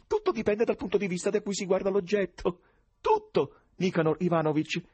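acuto, forte, ciarliero con risatine; cordiale sconosciuto. Acted. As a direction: sermoneggia divertito ridacchiando. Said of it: "con voce cordiale". (lo sconosciuto filosofeggia col presidente, cordiale e ridente)

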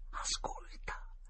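slow hushed whisper; slow frightened whisper. Acted. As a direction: breathe the word so nobody hears. Scared boy hushing his friend.

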